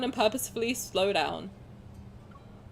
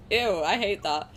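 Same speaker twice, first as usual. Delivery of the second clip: ordinary talk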